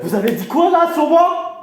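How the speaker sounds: loud, high-pitched outrage; angry challenge. Acted. Candidate challenging rival over an insult, loud outrage. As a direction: confront him, voice shooting up.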